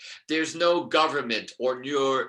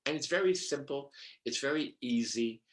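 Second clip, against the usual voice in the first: ordinary talk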